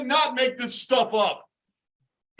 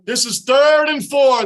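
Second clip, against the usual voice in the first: louder, exasperated shout; loud frustrated fan; 8 dB louder than usual. Real, not acted. Fan yelling at his team's play call, loud. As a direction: bellow it at the TV.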